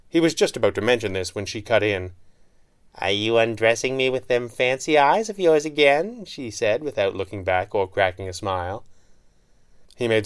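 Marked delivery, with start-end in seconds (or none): 3.0-6.2 higher, sly female voice; lightly voiced teasing heroine; woman voice. A narrator's reading.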